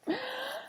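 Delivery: sharp high dramatic gasp; hammed-up gasp; big gasp. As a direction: gasp like a shocked child. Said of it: "very dramatic kindergartner".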